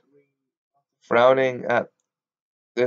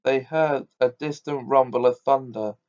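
ordinary delivery, nothing marked

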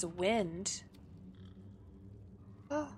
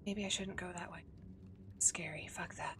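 soft scared whisper; real nervous whisper; 2 semitones lower, 7 dB quieter than usual. Real, not acted. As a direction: whisper so nothing hears. Creeping through dark area.

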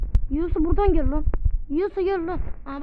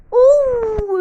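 startled cry; 9 semitones higher, 12 dB louder than usual. Real, not acted. Reacts to near death.

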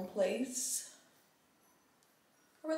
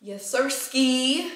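playful; 6 semitones higher, 10 dB louder, much slower than usual. Pretend. Playful sign-off flourish.

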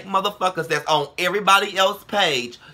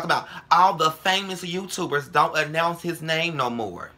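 ordinary delivery

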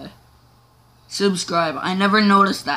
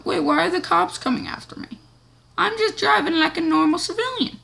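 mock innocent; 10 semitones higher than usual.

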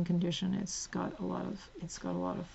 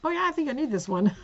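eager; 7 semitones higher, 8 dB louder, faster than usual. Retold or imitated. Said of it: "and I went".

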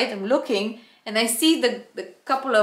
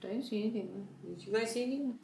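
innocent quiet denial; 15 dB quieter, much faster than usual. Retold or imitated.

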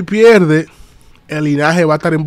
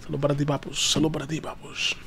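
much softer, almost whispered hello; hushed greeting; 3 semitones lower, 14 dB quieter than usual. Real, not acted. Streamer greets a viewer in a soft near-whisper.

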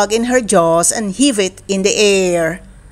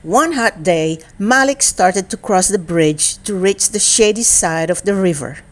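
plain reading voice

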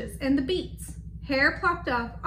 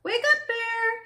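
high, held wake-up call; singsong call to a sleeper; storybook hare calling out, pitched high; 10 semitones higher, slower than usual. A narrator's reading. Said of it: "Hare called out".

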